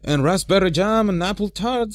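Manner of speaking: high, loud eager dwarf. A narrator's reading. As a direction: call out for jam like an eager dwarf. Dwarf Bifur orders food.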